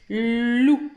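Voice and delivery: "loup" with a long held L; blended syllable. Shown as a demonstration. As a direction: read back "loup" as "lllou". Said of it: "vous avez écrit". (« loup » relu, L prolongé)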